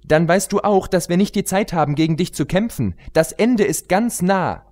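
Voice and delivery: put-on high, eager young pony voice; pony heroine. Pony heroine questioning the queen, high and urgent.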